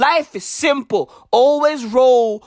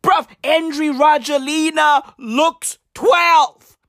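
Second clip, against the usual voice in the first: usual speaking voice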